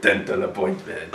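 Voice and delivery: slow, soft whisper through laughter; laughing whisper. Shown as a demonstration. Friend savouring the joke in a slow whisper. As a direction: breathe the catchphrase out under a laugh. Repeating catchphrase while laughing.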